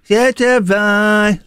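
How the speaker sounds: slow sung-out imitation; imitating background singer. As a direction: drawl it like the distant singer.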